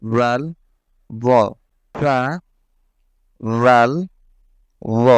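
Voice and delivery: ফা, রাল, র said with the tongue tip on the upper front teeth, light and thin; tongue tip on upper teeth, light articulation; thin, light Arabic ফা রাল র; Arabic letter demo. Shown as a demonstration. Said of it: "উচ্চারণ করতে হবে ফা".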